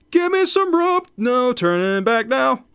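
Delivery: high shouted villain line, slowing at the end; high, loud madman's cry; gleeful blacksmith cry. Acted. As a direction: crow it out like a mad blacksmith.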